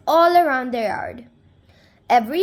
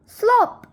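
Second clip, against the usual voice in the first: high, loud "Slop!" sound effect; splashy slop; 10 semitones higher, 4 dB louder than usual. A narrator's reading. Reading to kids, the bucket lands: a high, loud "Slop!". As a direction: pop it out like a splash.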